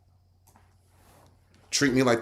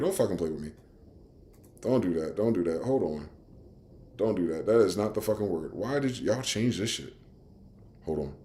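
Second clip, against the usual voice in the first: annoyed muttering; 6 semitones lower, 9 dB quieter than usual. Real, not acted. Annoyed muttering at changed website.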